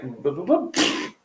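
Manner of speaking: slow, spaced-out boop, boop, boop like a ticking counter; boop, boop, boopを一つずつゆっくり区切って; mock counter beeps. Mimics analytics counter ticking up.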